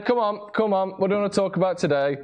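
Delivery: ordinary talk, nothing marked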